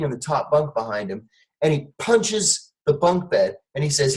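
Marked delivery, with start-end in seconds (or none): none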